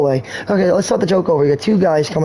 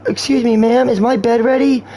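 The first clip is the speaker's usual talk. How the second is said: high-pitched, repetitive nut voice; imitating a nut; 7 semitones higher than usual. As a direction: play a pestering nut, pitched well up. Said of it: "sitting next to a nut saying".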